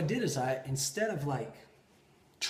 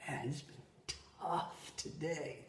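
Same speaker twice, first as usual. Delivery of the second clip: soft, drawn-out sigh of a line; hushed, worn-out admission; weary confiding; 11 dB quieter than usual. Retold or imitated. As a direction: say it slowly and quietly, stretching "tough". Same quote slowed and softened.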